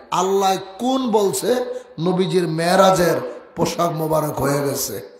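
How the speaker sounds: ordinary talk, nothing marked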